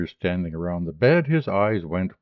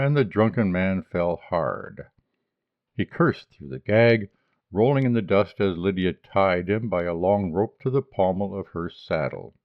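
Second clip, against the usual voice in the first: ordinary delivery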